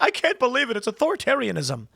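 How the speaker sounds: high-pitched mimicked indignation; mock outrage. Commentator protesting Kimmel's firing, mock outrage. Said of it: "he's like".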